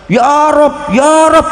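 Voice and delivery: loud, high cries to the Lord; frantic shouting prayer. Retold or imitated. Passengers in the storm shouting to God, frantic and loud. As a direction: cry out to God in panic. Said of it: "Teriak-teriak".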